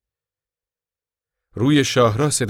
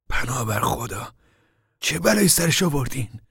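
admiring amazement; 6 semitones higher than usual. A narrator's reading. Al standing over the knocked-out deputy, admiringly amazed. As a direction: marvel in a higher voice. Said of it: "با لحنی ستایشگرانه گفت".

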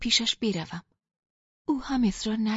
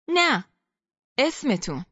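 shouting over the crowd; 3 semitones higher, 4 dB louder, slower than usual. Acted. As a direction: call out over the chanting crowd. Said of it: "داد زد". (فریاد ملایم بر سر صدای جمعیت)

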